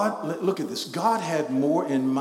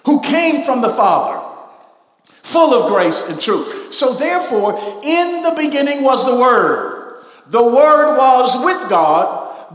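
high, loud preacher's proclamation; impassioned full-force preaching; 9 semitones higher, 11 dB louder than usual. Real, not acted. Preacher proclaiming the Word became flesh, impassioned full-force preaching. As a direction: build to a ringing sermon climax. Climax of the sermon.

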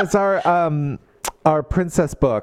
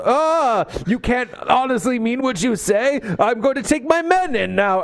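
high, pompous mock-heroic voice; hammed-up prince; 9 semitones higher than usual. Friend mocking Prince Charles's rescue speech, high hammy voice. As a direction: play the clueless hero who won't listen.

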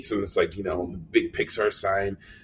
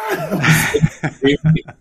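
laughing; 4 dB louder, much slower than usual. Real, not acted. Laughing recalling the shout.

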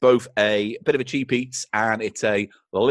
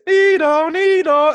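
siren imitation; 5 dB louder, slower than usual.

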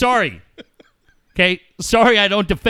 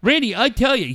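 normal talking voice

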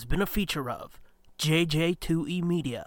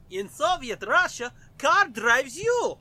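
put-on Russian accent; 5 semitones higher, 9 dB louder than usual. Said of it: "Let's go Russian".

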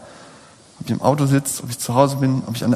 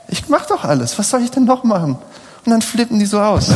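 exasperated outburst; 8 semitones higher than usual. Retold or imitated. Preacher acting out his anger at his children, high and exasperated. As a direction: pitch jumps much higher on a despairing question. Father voicing his own frustrated thoughts.

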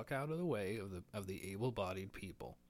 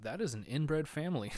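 raised, blunt remark said for him to hear; said out loud; 6 semitones higher, 6 dB louder than usual. Retold or imitated. Retold blurting it out loud beside the family. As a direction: say it out loud right next to them. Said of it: "and I said out loud".

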